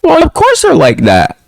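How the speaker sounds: a touch of high sarcasm; high mocking retort. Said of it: "I'm just like".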